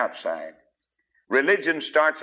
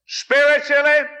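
sudden much higher, louder cry; preacher's shout; 7 dB louder than usual. Real, not acted. Preacher cries out to the congregation, loud. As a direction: blast the word out to the pews. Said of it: "preacher's emphatic shout".

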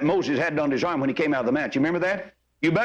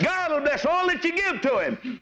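high, slow pulpit proclamation; fervent preacher, stretched high phrases; impassioned preaching; 10 semitones higher than usual. Real, not acted. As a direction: proclaim the promise to the congregation. Preaches at full force.

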